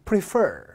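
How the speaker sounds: "prefer" as pri-FER, falling on FER; stress on second syllable. Shown as a demonstration. Teacher leading the class together, "prefer" stressed on the last syllable. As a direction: lean on the back half of "prefer". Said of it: "강세 뒤에 있습니다".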